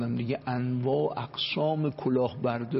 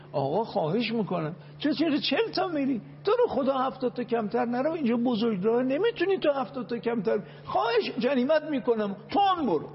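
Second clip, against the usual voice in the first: higher, begging plea of a traffic cop; mock pleading policeman; 8 semitones higher than usual. Mock police begging slow driver.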